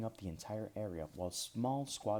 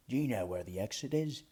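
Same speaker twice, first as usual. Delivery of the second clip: put-on gruff Highland accent; 2 semitones higher, 5 dB louder than usual. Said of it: "it spoke in a gruff Highland accent".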